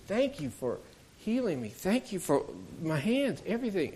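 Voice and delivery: a hint of weeping gratitude on his knees; crying thanks. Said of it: "he reached out crying to Jesus".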